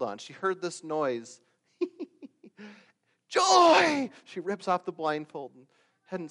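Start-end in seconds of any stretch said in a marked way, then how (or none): 1.8-2.3 high-pitched giggle imitating an aunt; short, squeaky mimicked laugh; imitated laugh. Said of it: "It's Joy's laugh". 3.3-4.1 high, loud shriek of recognition; long, high shouted greeting; delighted cry. Retold or imitated. Mother recognises laugh, cries out.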